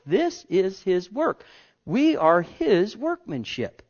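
measured declaration; 1 emphatic preaching. Real, not acted. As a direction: drive home each word.

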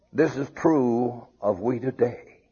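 ordinary delivery

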